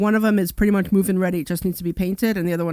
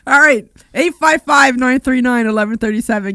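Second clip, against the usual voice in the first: hiccup giggles; 4 semitones higher, 8 dB louder than usual. Real, not acted. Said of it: "'cause I'm laughing too hard now".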